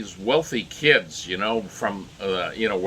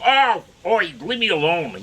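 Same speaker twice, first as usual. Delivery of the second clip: exasperated mock Australian dad, higher voice; bad Australian accent; 7 semitones higher than usual. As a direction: fend them off in a hammy Australian accent. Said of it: "my Australian accent is really, really terrible".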